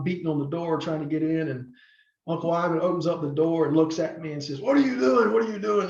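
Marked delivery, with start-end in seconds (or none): 4.6-5.9 higher, put-on wrestling gimmick voice. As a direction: slip into the ring persona for a second. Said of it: "in the gimmick voice".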